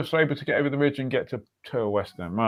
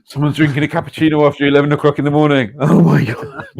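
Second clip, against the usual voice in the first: louder, hammed-up scandalised gasp of disbelief; louder mock horror at a cappuccino; mock outrage; 8 dB louder than usual. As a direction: play the appalled Italian diners. Italians scandalised by late cappuccino.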